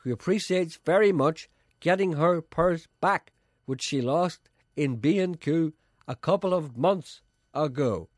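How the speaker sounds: slow, measured radio read; reading out a listener message. Real, not acted. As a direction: read the notice out slowly.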